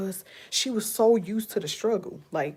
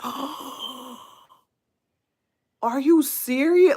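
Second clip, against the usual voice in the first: shocked disbelief; 6 semitones higher, 4 dB louder, much slower than usual. Retold or imitated. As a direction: gasp then a rising, louder "are you serious". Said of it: "I was just like".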